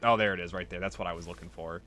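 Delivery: ordinary delivery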